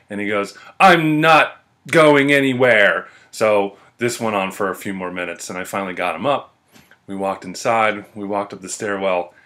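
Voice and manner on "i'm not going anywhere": loud, slow, raised mock whine; hammed-up defiant bellow; stubborn drunk protest. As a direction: imitate a drunk digging his heels in. Drunk friend's stubborn refusal, retold.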